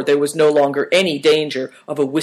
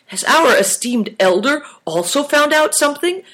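direct, much higher-pitched question; straight out; 12 semitones higher than usual. A narrator's reading. Big Tiger questioning the esteemed elder, asking straight out. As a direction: ask it straight out, pitched high. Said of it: "asked Big Tiger straight out".

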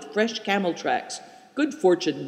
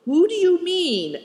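high, innocent question; unsuspecting; 12 semitones higher, 3 dB louder than usual. A narrator's reading. Companion asking who the scoundrel is, unsuspecting. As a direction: ask it innocently, pitched well up. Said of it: "all unsuspecting".

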